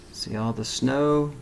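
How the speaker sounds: ordinary delivery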